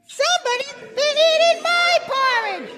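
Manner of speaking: very high, squeaky cub voice; Baby Bear. Shown as a demonstration. Performer helps out the shy cub, very high little voice.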